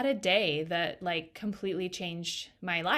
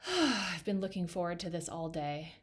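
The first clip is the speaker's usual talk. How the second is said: sigh of relief; 4 dB quieter than usual. Retold or imitated. Client settling into the salon chair, sighing with relief. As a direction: imitate a big relieved exhale, then soft words. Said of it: "takes this big sigh of relief".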